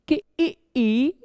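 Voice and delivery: इ and ई each stretched out slowly; drawn-out vowels. Shown as a demonstration. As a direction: sound out इ, then ई, long and slow. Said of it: "अब इनको समझने के लिए आप ये समझ लीजिए". (धीरे-धीरे खींचकर इ, ई का उच्चारण)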